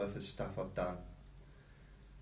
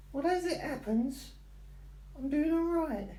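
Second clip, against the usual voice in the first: very high, slow put-on dog voice. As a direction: answer for the dog in a squeaky voice. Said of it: "you don't normally talk like that".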